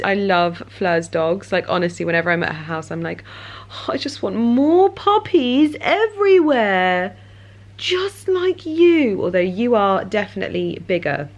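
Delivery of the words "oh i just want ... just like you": puppy talk. Real, not acted. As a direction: coo at the puppy, drawn out.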